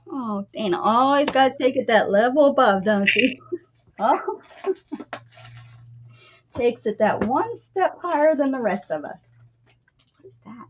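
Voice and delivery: ordinary talk, nothing marked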